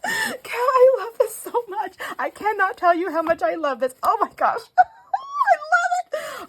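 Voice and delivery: very high, squeaky, tearful gushing; squeaky excitement. Real, not acted. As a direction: squeal with joy, near tears. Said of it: "I'm getting all squeaky".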